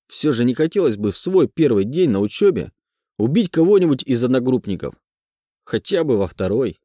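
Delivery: usual speaking voice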